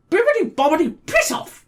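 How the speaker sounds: high shrieking witch incantation, loud; cackling witch casting a spell. Retold or imitated. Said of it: "you need to have the magic words".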